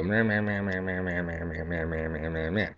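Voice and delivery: fading blah-blah imitation of a nagging coach; dismissive gibberish, trailing off; mocking meh. Mocking the coach's endless talking.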